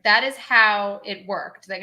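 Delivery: usual speaking voice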